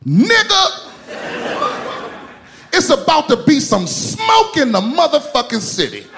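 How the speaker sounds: put-on high, loud, enraged old woman; furious grandma. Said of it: "She goes".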